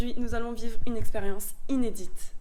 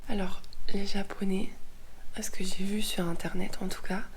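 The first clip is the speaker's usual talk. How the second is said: whispering. Real, not acted. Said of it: "Je parle doucement parce que Antoine, il dort encore".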